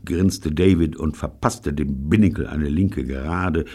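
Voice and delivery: ordinary delivery, nothing marked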